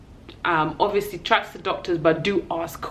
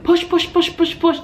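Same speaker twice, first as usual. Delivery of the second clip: coaching push; 12 semitones higher, 5 dB louder than usual. Retold or imitated.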